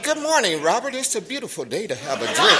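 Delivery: lightly voiced smooth tempter; sweet-talking disease. Disease sweetly greeting Robert, mock gentle tempting. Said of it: "he didn't never come to me and said".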